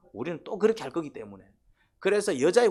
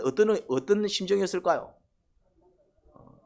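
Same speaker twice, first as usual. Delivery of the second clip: ordinary delivery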